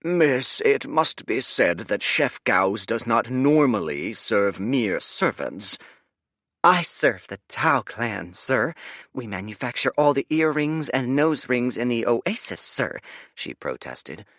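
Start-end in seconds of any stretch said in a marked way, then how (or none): 0.0-5.8 looking down on a servant; sniffy server. Acted. Reluctant server. 6.6-13.0 high, sing-song, put-on sweetness; fake sing-songy voice. Spy Girl keeps fake voice.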